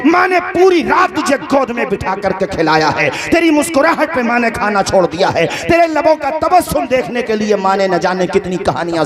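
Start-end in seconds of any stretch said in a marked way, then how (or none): 0.1-3.1 high, impassioned plea; fervent preaching. Real, not acted. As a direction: preach it with rising fervour. Fervent appeal about mothers. (ऊँची, जोशीली तक़रीर की आवाज़)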